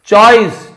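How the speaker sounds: teacher's loud high model of choice; loud model word. Shown as a demonstration. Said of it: "choice यानी चुनाव करना".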